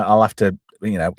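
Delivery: usual speaking voice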